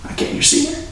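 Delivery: halting, near-tearful plea to the jury; choked up. Acted. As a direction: voice jumping high and thin with emotion. Lawyer overcome recounting the attack.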